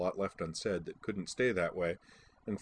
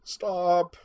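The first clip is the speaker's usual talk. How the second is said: high, loud helpless plea; acted overwhelmed pleading; 10 semitones higher, 7 dB louder than usual. A narrator's reading. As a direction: beg for mercy from the joke. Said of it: "Starbuck pleaded".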